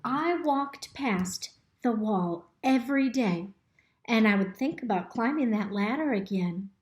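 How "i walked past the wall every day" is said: ordinary delivery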